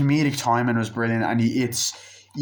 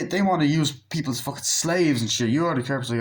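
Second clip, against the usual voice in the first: ordinary talk, nothing marked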